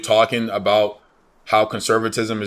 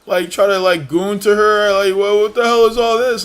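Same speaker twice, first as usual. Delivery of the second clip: high, loud sneering whine; mocking whiny gamer; 10 semitones higher, 6 dB louder than usual. Streamer parodies gamers whining about a woke game, in a high loud whine. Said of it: "Oh, well".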